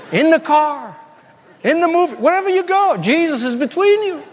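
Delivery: playful warning. Retold or imitated. Pastor teasing kids about dates, high laughing warning. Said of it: "things to them like".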